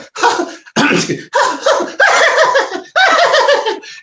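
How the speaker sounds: high manic cackle imitated; crazy laugh. Shown as a demonstration. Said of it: "I'm gonna try, he's like".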